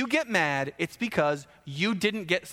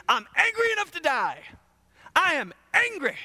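high-pitched mock tantrum; overacted indignant outburst; hammed-up anger; 11 semitones higher, 3 dB louder than usual. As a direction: protest like a furious, petulant Jonah. Jonah's angry answer, acted.

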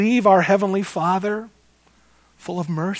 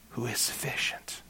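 hushed; 5 semitones lower, 13 dB quieter than usual. Acted. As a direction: drop to a near whisper on the question. Preacher drops to a hush.

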